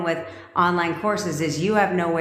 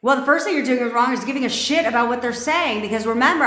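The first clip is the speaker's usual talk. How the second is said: ordinary talk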